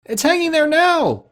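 put-on very high young boy; boy's voice. A narrator's reading. Boy answering Scrooge from below, high boyish voice. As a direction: pipe up, astonished. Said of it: "replied the boy".

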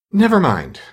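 high, loud falling exclamation of relief; great relief. A narrator's reading. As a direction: drop the matter with great relief. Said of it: "with an air of great relief".

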